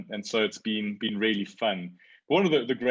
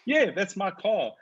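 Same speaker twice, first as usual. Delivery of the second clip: normal talking voice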